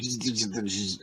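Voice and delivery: short repeated electrical zaps; imitated electric buzz. Robot short-circuited by a battery, soft buzzing zaps. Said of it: "and she went".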